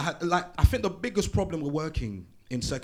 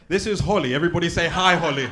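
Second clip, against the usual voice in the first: louder, higher call to the room; louder host calling out to the crowd; crowd leader; 5 semitones higher, 9 dB louder than usual. Real, not acted. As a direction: get the whole room to say hi. Leading the crowd in greeting.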